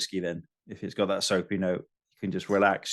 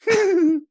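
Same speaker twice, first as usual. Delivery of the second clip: high, loud imitation cackle; Ricky Gervais laugh; 6 dB louder than usual. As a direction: burst into a piercing comic laugh. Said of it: "that was my, uh, Gervais laugh".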